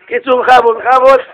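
ordinary delivery, nothing marked

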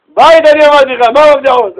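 loud, high mock scolding wife; exasperated outburst. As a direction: scold the husband in loud disbelief. Wife snaps at husband about keys. (חיקוי אישה נוזפת בקול רם)

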